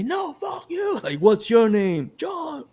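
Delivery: strained, high-pitched mock road-rage yelling; acted shouting match. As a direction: trade insults like two angry drivers, squeezed high. Acted shouting match between two drivers.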